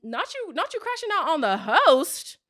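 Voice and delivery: high, incredulous sass; mock shocked at the crash out; sassy disbelief. Speaker reacting to Mike crashing out on the host, incredulous. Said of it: "But I was like".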